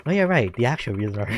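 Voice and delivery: usual speaking voice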